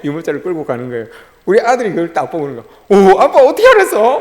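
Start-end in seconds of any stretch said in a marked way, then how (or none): 3.1-4.2 high, loud wide-eyed wonder; amazed child. Retold or imitated. Son asking father how he knew, loud high amazement. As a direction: imitate a boy astonished at his dad.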